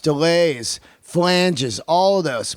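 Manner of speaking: ordinary talk, nothing marked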